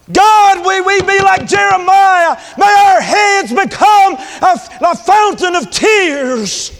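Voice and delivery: loud, high, drawn-out plea to God; slow shouted prayer, building in force; impassioned preached prayer. Real, not acted. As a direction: cry out to God from the pulpit. Prayer cried out at full force.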